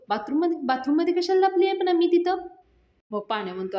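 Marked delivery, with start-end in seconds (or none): 0.1-2.5 high, loud alarmed question; panicked. Retold or imitated. Yasha to Panya, hearing Nami is hiding: panicked outburst. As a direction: blurt it out in alarm. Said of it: "यशा panic होतो".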